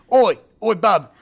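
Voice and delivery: loud mock British accent, Cockney-style "Oi, oi"; hammed-up British accent, shouted; bad British accent. Host mimicking British dinosaur hunters in a loud bad British accent. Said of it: "Please don't do the accent".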